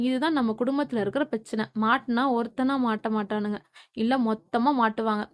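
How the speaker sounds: plain reading voice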